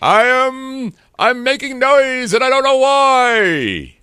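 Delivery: loud, drawn-out, high-pitched holler; over-the-top yelled celebrity impression; Robin Leach. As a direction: bellow every word slowly, way up high. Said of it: "that's my Robin Leach impression".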